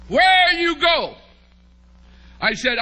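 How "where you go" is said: high, slow, puzzled question in broken English; Thai tour guide's broken English. Retold or imitated. Tour guide asks the stranded missionary, lightly mimicked and slow. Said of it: "she said to me".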